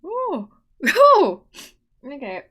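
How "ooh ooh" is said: flustered squeal. Real, not acted. Flustered by a flirty voice clip.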